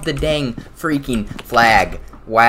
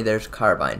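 usual speaking voice

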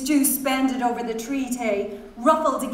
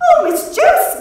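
high, loud shriek of protest; very high, loud outcry; 11 dB louder than usual. A narrator's reading. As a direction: squeal it in scandalised protest. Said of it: "Miss Kennedy protested".

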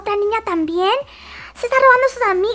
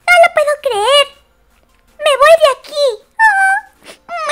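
higher, louder offended outburst; upset rich girl; 6 semitones higher, 3 dB louder than usual. Pretend. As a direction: storm off, hurt and loud. Da voz a la niña rica. (indignada, más aguda y fuerte)

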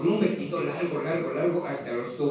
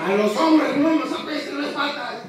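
higher and louder; emcee shout; 7 semitones higher, 4 dB louder than usual. Real, not acted. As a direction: bellow it out for a reaction. Emcee shouts statement to crowd.

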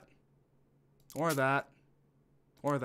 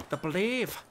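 higher Scottish "brave", tongue flicked on the r; 5 semitones higher than usual. Shown as a demonstration. Streamer explaining Scottish r's, flicking the tongue on "brave". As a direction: tap the r in "brave", Scottish-style. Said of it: "flick the tongue in a way".

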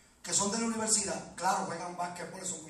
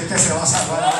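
louder call for the congregation to repeat; raised preaching voice; loud exhortation; 4 semitones lower, 13 dB louder than usual. Real, not acted. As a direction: proclaim it out to the whole church.

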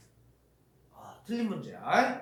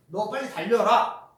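louder, ordering; command; 4 semitones higher, 7 dB louder than usual. Shown as a demonstration. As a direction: demand it firmly. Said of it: "이게 명령이야". (크게 명령하는 말투)

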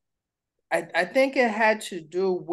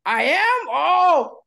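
surprised; 10 semitones higher, 7 dB louder, much slower than usual. Retold or imitated. Mock shock at wife's remark.

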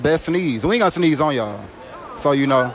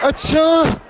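loud, high fake sneeze; sneezing; 10 semitones higher, 4 dB louder than usual. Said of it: "who got the best sneeze".

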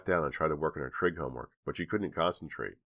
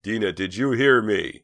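loud, higher calling scream; screaming after her; 5 semitones higher, 9 dB louder than usual. A narrator's reading. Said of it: "he was screaming after her".

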